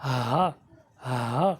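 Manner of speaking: ہا low and breathy from the throat; slow guttural ہا demo; throat sound. Shown as a demonstration. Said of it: "اس کی آواز کس طرح آئیں گی".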